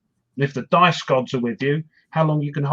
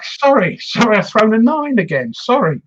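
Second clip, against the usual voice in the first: lightly voiced apologetic Englishman, a bit higher; apologetic English player; 5 semitones higher, 3 dB louder than usual. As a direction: keep saying sorry as if embarrassed by the lucky roll. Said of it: "the English started apologising".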